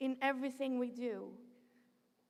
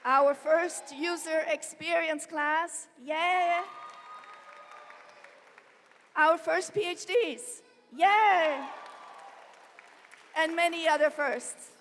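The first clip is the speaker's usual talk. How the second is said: loud, higher, celebratory announcement; real cheering; 5 semitones higher, 10 dB louder, slower than usual. Real, not acted. University president cheers the first graduating classes, excited and loud. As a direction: proudly cheer each milestone over a big crowd.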